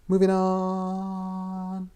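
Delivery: very slow, high, held-out words; drawn-out segue. Real, not acted. Host ending a rant, long drawn-out segue. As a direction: stretch it out to close the subject. Ends rant, changes the subject.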